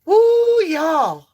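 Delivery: loud high whoop, pitch soaring; whooping yell. Real, not acted. Psychic whooping at a powerful card message. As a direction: let out a big excited woo. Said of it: "I'm sorry I'm yelling".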